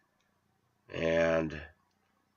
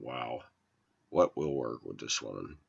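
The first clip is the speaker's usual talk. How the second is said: muttered aside; 8 semitones lower, 8 dB quieter than usual. Retold or imitated. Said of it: "exasperated inner thought".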